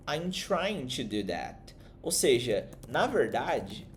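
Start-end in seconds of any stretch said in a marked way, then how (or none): 0.1-1.7 slow model line. Shown as a demonstration. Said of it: "Então, ó".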